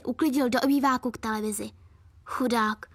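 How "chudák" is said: plain reading voice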